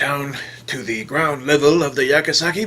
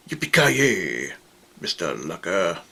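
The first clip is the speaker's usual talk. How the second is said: catchphrase delivery; 10 semitones lower, 4 dB quieter than usual. Retold or imitated. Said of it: "and say".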